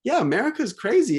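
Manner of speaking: high-pitched quoted verdict; animated retelling, voice jumping high; imitated friend's exclamation. Quoting the friend's exasperated verdict.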